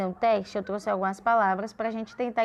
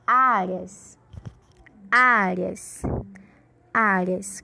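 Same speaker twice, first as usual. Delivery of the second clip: "Áreas" with a long, strong opening "Á"; stress on A; 2 semitones lower, much slower than usual. Shown as a demonstration. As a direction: hit and hold the first vowel of "Áreas". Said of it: "É a sílaba A".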